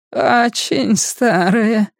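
put-on high, slowly stretched old crone; creepy old woman. A narrator's reading. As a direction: draw it out high and slow, with sly menace. Old woman repeats, eyeing Beverly slyly. (жутковатая старуха, высоко и тягуче)

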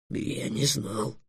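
very soft, very slow admission; embarrassed dragon. A narrator's reading. Dragon rebuked by the fairy, embarrassed. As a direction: falter it out quietly, abashed. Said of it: "смутился Эллен".

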